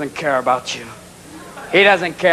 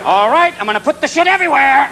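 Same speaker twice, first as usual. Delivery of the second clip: threatening cat; 7 semitones higher than usual. Acted. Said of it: "your cat's in the cat box going".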